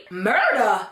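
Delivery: drawn-out, incredulous echo of the word; stunned disbelief. Retold or imitated. Appalled friend reacting to a death threat, stunned disbelief. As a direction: repeat it as if appalled.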